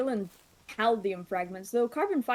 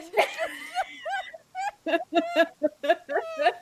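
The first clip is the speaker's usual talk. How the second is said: high, loud burst of laughter; shrieking laugh; 13 semitones higher, 5 dB louder than usual. Real, not acted. Laughing at her own joke.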